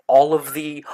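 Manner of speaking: usual speaking voice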